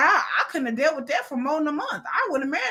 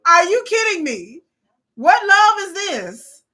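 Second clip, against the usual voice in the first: usual speaking voice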